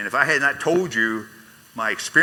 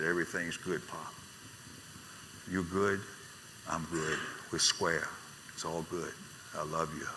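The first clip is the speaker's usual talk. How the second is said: much softer, tender murmur at the bedside; gentle whisper; 4 semitones lower, 12 dB quieter than usual. Retold or imitated. Son to his dying father in hospital, soft and tender. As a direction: hush it like words in a dying man's ear. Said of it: "I was just whispering in his ear".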